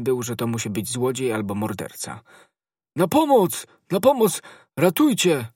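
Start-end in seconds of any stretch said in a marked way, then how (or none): 3.0-5.5 loud, high-pitched yells; screaming for help. A narrator's reading. Servant fearing a thief or murderer, screaming for help. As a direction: cry out in alarm for rescue. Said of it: "zaczął wrzeszczeć".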